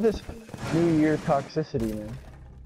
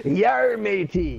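loud, high pirate shout; 8 semitones higher, 5 dB louder, much slower than usual. Pretend. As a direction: yell it like a cartoon pirate.

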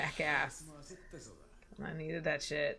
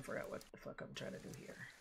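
whispered inner thought, much softer; 17 dB quieter than usual. Retold or imitated. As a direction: breathe it like a confused thought. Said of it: "I was like".

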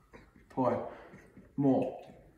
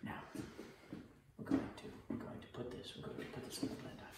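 softer, whispered instructions; 11 semitones lower, 22 dB quieter, faster than usual. Acted. Chef whispering cooking steps to camera, hushed. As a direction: murmur it like a secret aside.

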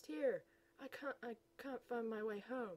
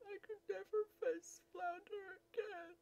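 crying; 9 semitones higher than usual. Acted. Crying over Flounder.

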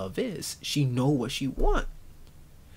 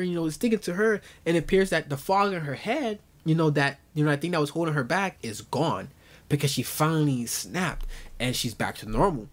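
normal talking voice

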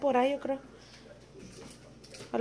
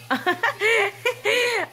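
words dissolving into real laughter; laughing; 9 semitones higher than usual. Real, not acted. Mother telling friends about the fake gold, laughing hard. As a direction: laugh through the punchline.

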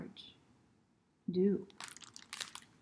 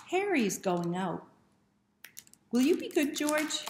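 Harry's voice; 7 semitones higher, 7 dB louder than usual. A narrator's reading. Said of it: "asks Harry".

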